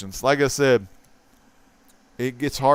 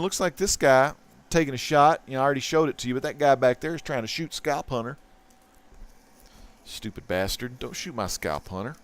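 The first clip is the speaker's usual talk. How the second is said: ordinary delivery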